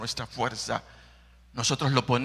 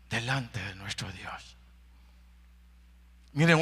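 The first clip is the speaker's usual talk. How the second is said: usual speaking voice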